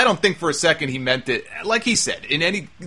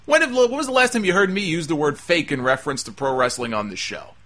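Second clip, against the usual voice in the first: ordinary talk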